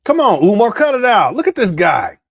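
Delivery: loud, exasperated call to cut it out; loud callout. Real, not acted. Calling out at the stream.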